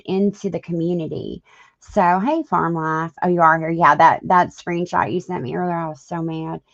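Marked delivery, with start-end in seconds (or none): none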